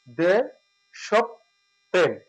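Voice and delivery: দেশপ্রেম very slowly, syllable by syllable, "desh-ob-prem"; correct pronunciation. Shown as a demonstration. Teacher demonstrates the hasanta break for the class, slow. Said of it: "এখানে হর্ষণ হবে".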